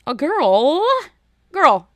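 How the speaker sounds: loud, high disbelief at the word; incredulous. Real, not acted. Incredulous reaction to contestant.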